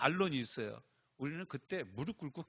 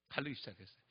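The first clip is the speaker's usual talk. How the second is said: 가르기 repeated much softer; quiet echo; 4 semitones lower, 7 dB quieter, faster than usual. Shown as a demonstration. Preacher echoing 가르기 softly to himself. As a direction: drop to a soft echo on 가르기.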